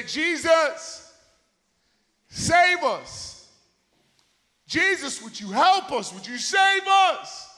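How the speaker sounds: high, loud desperate plea; acted freaking out. Drowning disciples' last resort crying to Jesus, acted panic. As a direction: call out for rescue, stretching the last cry. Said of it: "and they're freaking out".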